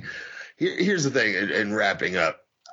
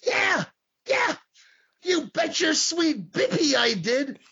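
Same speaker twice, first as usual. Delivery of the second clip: high, slow cartoonish old man voice; high wobbly mock old-man voice; imitating an old-timer; slower than usual. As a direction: ham up a creaky old boss. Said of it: "And he says".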